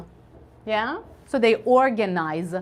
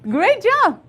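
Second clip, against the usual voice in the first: excited praise; 9 semitones higher, 5 dB louder, slower than usual. Real, not acted.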